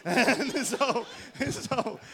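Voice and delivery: loud, high laughing speech; real laughter breaking into the sentence. Real, not acted. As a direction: laugh through the words. Laughing at own story.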